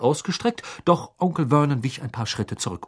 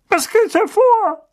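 high, slow indignant demand; blustering uncle voice; 7 dB louder than usual. A narrator's reading. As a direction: demand an answer, outraged.

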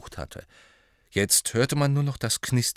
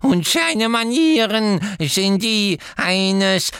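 slow, higher, loud reverent croak; croaking elf; 11 semitones higher, 6 dB louder, slower than usual. A narrator's reading. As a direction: croak it like a devoted old servant. Kreacher then Dobby cut in.

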